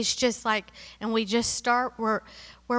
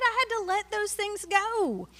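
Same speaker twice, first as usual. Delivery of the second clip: high but easing back down; same line, calmer, still lifted; 9 semitones higher than usual. Acted. Repeated, softer after climax.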